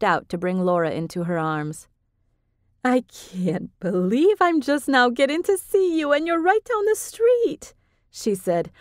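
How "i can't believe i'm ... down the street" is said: put-on high, gushing older woman; Vivian voiced high. A narrator's reading. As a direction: voice her bright and effusive, much higher. Vivian greets Laura warmly.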